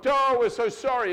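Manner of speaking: high, loud group whine, hammed up; mock apologizing children. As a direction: overdo the kids' sorrowful chorus. Mimics children all apologizing.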